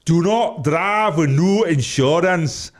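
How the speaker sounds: slow, loud, high announcer voice; put-on voice. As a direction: boom out the warning slowly. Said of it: "Who was that?".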